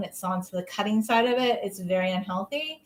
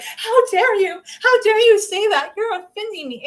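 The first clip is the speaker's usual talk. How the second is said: higher and louder hammed-up outrage; mock offended guy; 10 semitones higher, 7 dB louder than usual. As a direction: play an indignant beer-belly guy, overacted. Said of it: "came along and be like".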